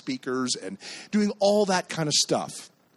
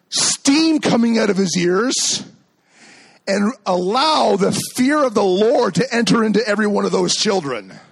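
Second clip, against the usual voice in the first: loud, higher, emphatic pulpit delivery; preaching at full force; 7 semitones higher, 7 dB louder than usual. Real, not acted. Pastor proclaiming how scary Dad can be, full-force preaching. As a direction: proclaim it in big, separated phrases.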